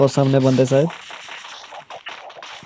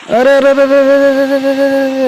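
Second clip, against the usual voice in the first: high loud repeated अरे, rising into a held wail; panicked shouting; 9 semitones higher, 4 dB louder than usual. Real, not acted. Gamer panicking as his character gets shot down. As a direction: cry out in panic as the enemy hits.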